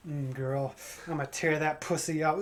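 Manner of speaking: low, soft would-be seductive murmur; failed dirty talk. Pretend. Comic acting out his own awkward dirty talk, soft and low. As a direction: try to sound sexy and fail. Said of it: "in the heat of passion".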